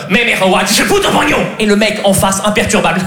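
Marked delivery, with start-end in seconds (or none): 0.1-1.4 angry mock Chinese accent. Actor improvising for director, angry mock Chinese accent. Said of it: "Le chinois énervé".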